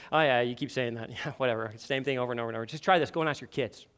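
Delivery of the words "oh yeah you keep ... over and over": dismissive. Retold or imitated. Quoting a dismissive reply to spouse.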